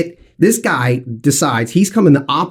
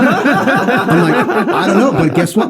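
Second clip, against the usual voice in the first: laughing; 4 semitones higher, slower than usual. Real, not acted. Laughing while retelling.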